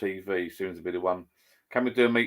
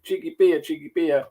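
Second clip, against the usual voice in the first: higher, lightly voiced toddler repeating cheeky beer; imitating grandson; 9 semitones higher, 6 dB louder than usual. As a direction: mimic a two-year-old proudly repeating it. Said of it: "He says".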